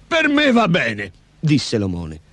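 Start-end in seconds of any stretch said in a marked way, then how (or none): 0.1-1.1 high, drawn-out ogre character. A narrator's reading. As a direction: agree in a high, slow creature voice. The ogre agrees to deal. (voce acuta da orco)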